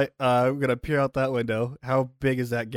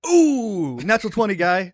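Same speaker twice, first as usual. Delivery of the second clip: higher, louder burst of real delight; thrilled; 8 semitones higher, 9 dB louder than usual. Real, not acted. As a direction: cheer the lucky roll. Rolls a natural twenty.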